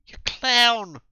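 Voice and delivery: high, loud furious yell; furious insult. Acted. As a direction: spit it out in rage. Woman insults him furiously.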